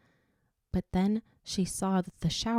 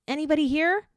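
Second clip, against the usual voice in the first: high, louder nervous call out; quivering call; 10 semitones higher, 6 dB louder, faster than usual. A narrator's reading. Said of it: "she called in a quivering voice".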